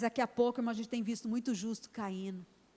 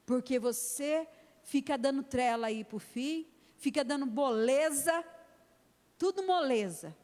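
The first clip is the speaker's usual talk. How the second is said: fiery preaching; 2 semitones higher than usual. Acted. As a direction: preach hard at the congregation. Preaching to the congregation. (pregação inflamada e enérgica)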